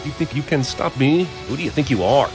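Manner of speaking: ordinary talk, nothing marked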